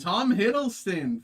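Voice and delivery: loud, high, drawn-out cheer; excited shout. Real, not acted. Vlogger revealing the Tom Hiddleston card, excited shout. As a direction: announce him with joy.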